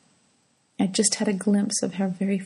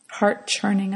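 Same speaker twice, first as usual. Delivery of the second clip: plain reading voice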